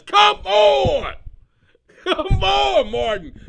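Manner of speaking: high, loud joyful yell; loud high come on; delighted shout. Real, not acted. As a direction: holler it in delight. Delighted outburst.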